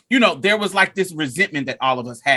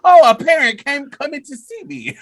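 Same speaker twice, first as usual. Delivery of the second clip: higher, eager teacher surprised a parent came; naive teacher; 8 semitones higher, 4 dB louder than usual. Retold or imitated. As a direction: play the clueless teacher, chirpy and high. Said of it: "He just was like".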